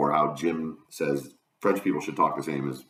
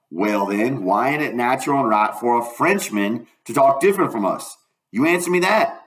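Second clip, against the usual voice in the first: lightly voiced country boy, rising to a challenge; Huck, challenging; 6 semitones higher, 5 dB louder than usual. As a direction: press the argument, pitching up at the end. Voices Huck pressing his argument.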